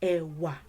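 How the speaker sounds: E wa with two low tones; low twice. Shown as a demonstration. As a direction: drop both syllables of E wa low. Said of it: "You're still going low twice".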